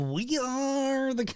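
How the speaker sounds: mimicking an old cowboy, high and drawn out; mock Western old-timer voice; old Western dude. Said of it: "an old dude from a Western".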